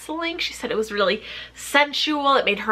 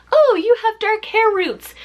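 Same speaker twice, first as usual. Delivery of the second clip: mock quote; 8 semitones higher, 4 dB louder than usual. Quoting someone's remark on her armpit.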